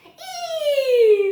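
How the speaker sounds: high, loud squeal of scared delight; high children's scream. Acted. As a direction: shriek like thrilled kids. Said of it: "the krill children screamed".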